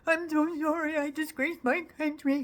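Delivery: high, whiny mock sob; mock whining. Mocking convicted rioters, whining to the judge. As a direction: whine it like a guilty crybaby begging. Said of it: "whined and bawled and cried to the judge".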